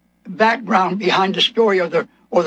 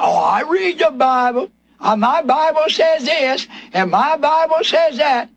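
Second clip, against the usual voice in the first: higher-pitched mimicking of know-it-all churchgoers; mock boasting believer; 4 semitones higher than usual. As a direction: parrot them in a raised, mocking voice. Mocking people who claim Bible reading.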